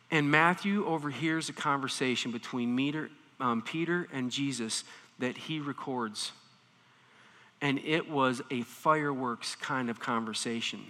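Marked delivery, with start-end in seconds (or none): none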